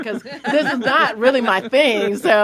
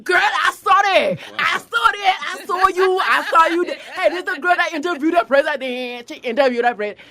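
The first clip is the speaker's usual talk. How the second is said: excited TSA agent; 8 semitones higher than usual. Retold or imitated. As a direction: call it out to coworkers, thrilled. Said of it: "T S A was like".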